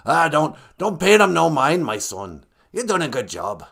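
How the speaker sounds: gruff pirate reassurance, loud then easing; Gitche Manadu. Acted. Creator telling his son to ignore critics, gruff pirate voice. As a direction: encourage him like a fatherly pirate. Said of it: "kinda sounds like a pirate".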